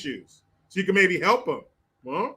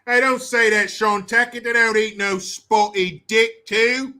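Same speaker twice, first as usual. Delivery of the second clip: loud, higher, hammed-up British scolding; mock British accent; 4 semitones higher, 5 dB louder, slower than usual. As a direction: object in a caricature British voice. Continues loud British parody.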